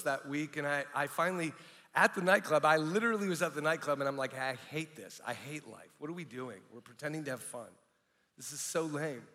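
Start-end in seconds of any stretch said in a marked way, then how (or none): 4.3-9.2 much softer, low and dejected; disgusted inner muttering. Retold or imitated. DJ to himself, fed up at the nightclub, dejected and hushed. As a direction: grumble it quietly to yourself.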